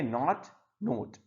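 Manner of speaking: नॉट open-vowelled, then नोट with a rounded "oh"; contrasting pronunciation. Shown as a demonstration. Teacher adding a missed pair for students to repeat, distinct pronunciation of नॉट and नोट. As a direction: set नॉट and नोट apart with a short pause. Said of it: "इस तरीके से repeat करो".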